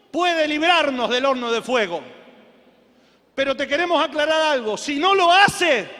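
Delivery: loud, defiant declaration building to a shout; fervent cry ending on a long amen; full-force preaching. Real, not acted. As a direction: rouse the congregation to a roaring climax.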